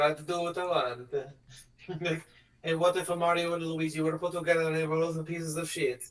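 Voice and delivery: ordinary talk, nothing marked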